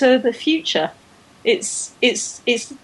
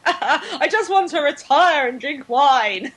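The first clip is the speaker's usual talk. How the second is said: slow, laughing bad German accent; hammy German accent through a laugh; 4 semitones higher, slower than usual. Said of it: "my terrible German accent".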